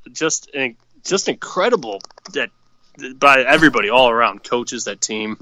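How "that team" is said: ordinary delivery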